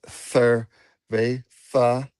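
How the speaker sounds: "Cerveza" slowly with th for z; lisped Cerveza. Shown as a demonstration. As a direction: drag out "Cerveza", lisping the z. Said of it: "You hear those lisps?".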